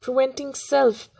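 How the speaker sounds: deliberate, phrase-by-phrase verse reading; poem recitation. Acted. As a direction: recite it firmly with pauses between short phrases. Recites poem after introducing it.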